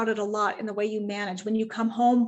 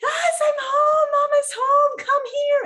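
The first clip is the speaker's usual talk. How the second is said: put-on much higher and louder, slow gushing baby talk; excited gushing; 8 dB louder, slower than usual. Owner coming home, gushing to puppy, high excited. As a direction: squeal it like greeting a puppy. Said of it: "and you're like".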